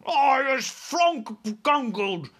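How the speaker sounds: high, loud, slow giant scream. Acted. Trussed giant screams at the soldiers, high and loud. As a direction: scream out the strange words. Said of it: "screamed the maid masher".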